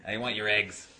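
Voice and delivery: imitated thick Boston accent; Boston waitress accent. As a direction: take an order in a mock Boston accent. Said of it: "she had a real thing".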